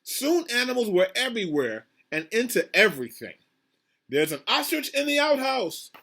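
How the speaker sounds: high-pitched, lilting list of surprises; animated storybook narration. A narrator's reading. Reader showing children animals all over town, animated storybook narration. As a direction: build wonder with each animal found. Reads picture book aloud.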